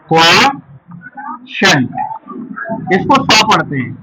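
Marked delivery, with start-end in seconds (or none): 0.1-1.9 very slow, split KWO - SHANT; syllable by syllable. Shown as a demonstration. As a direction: break "quotient" with a long pause in the middle. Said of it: "क्या पढ़ेंगे".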